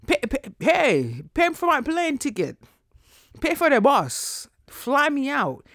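high, worked-up rant at friends; exasperated rant. Real, not acted. Rant at friends who won't visit.

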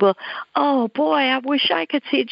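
high-pitched retold eagerness; eager wishing. Visitor longing to see the woman's vision, eager wishing. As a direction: share her wonder with a lifted, wistful exclamation. Teller answering her excited vision.

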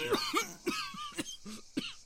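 hacking cough. Acted. Stoner coughing fit.

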